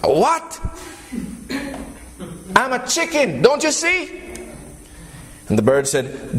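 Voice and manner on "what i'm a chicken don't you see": high, disbelieving chicken voice; chicken protesting. Acted. Chicken arguing with the eagle, high and incredulous. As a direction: push back like a startled little bird.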